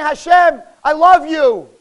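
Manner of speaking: high, loud cry to God; impassioned, full-voiced declaration; emotional prayer. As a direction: cry out your love to God. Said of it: "it has to be said with emotion".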